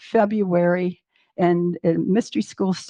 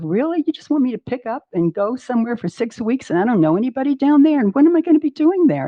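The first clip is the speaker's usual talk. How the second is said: incredulous protest; 4 semitones higher, faster than usual. Retold or imitated. Arguing with her inner voice about the trip, incredulous and building. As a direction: push back in rising disbelief.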